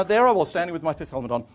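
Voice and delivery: ordinary talk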